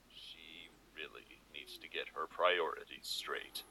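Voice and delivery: usual speaking voice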